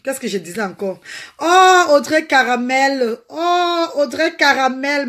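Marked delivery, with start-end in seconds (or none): 1.4-5.1 high, slow, teary cry of the name; overjoyed call to a fan; emotional greeting. Real, not acted. Greeting a viewer live.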